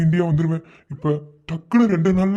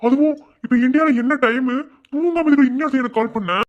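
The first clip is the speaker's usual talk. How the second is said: alarmed; 7 semitones higher, 3 dB louder than usual. Acted. Alarmed at her late call.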